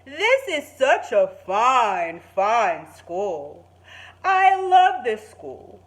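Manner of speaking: slow, loud, grand principal voice; Mister King announcing. Acted. Principal Mister King addresses all the students, slow and grand. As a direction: proclaim it proudly and slowly. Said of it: "Mister King announced".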